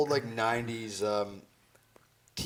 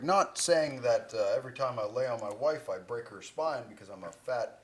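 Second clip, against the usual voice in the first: ordinary delivery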